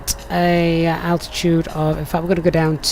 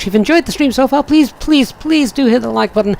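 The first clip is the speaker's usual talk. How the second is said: pleading; 7 semitones higher, 4 dB louder than usual. Real, not acted. Pleading for likes.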